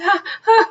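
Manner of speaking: high breathless burst of laughter; laughing fit. Acted. Rhino can't stop laughing at its own name, high laughing burst. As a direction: laugh until it hurts.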